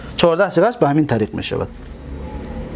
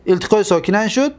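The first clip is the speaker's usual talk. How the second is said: ordinary talk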